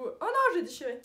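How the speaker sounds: loud, high yelp of dismay; real dismayed outcry. Real, not acted. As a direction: cry out at a sudden mishap. Tears the tape by accident.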